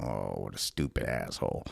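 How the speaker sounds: low, soft mutter at yourself; muttered self-reproach. Retold or imitated. Comic onstage scolding himself, low soft mutter. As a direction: curse yourself under your breath. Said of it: "I was standing there going".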